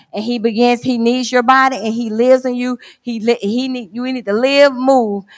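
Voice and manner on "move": ordinary talk, nothing marked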